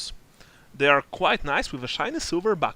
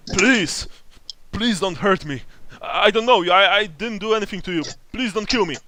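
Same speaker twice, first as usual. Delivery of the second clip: terrified; 5 semitones higher, 5 dB louder than usual. Acted. Begging NPC with sword at throat.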